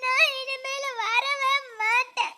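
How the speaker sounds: weepy, hurt complaint near tears; hurt wife crying that she waited since morning; tearful sulk. Acted. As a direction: sulk and threaten to leave, close to crying. Sulking wife who waited all morning.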